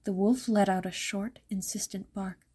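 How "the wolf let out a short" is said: plain reading voice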